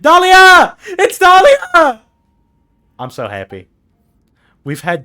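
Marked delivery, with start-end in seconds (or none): 0.0-2.0 high, loud fan squeal; excited scream. Real, not acted. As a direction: shriek with delight. Favourite character appears.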